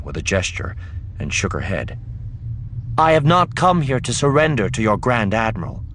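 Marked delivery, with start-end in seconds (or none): 3.0-5.8 high, loud formal defiance; defiant refusal. Acted. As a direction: stand up to the ruler before everyone. Leia defies the dynast publicly.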